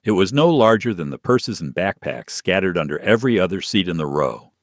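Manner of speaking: ordinary delivery, nothing marked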